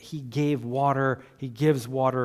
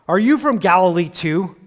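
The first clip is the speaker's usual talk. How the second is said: scornful retort; 3 semitones higher, 6 dB louder than usual. Acted. Pharisees mock Nicodemus.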